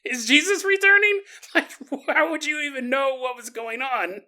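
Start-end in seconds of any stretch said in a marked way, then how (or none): 0.0-1.2 higher, louder astonished cry; amazed. Retold or imitated. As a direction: gasp at a wonder from heaven. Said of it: "You're like".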